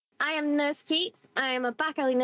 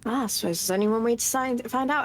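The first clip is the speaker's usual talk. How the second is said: ordinary talk, nothing marked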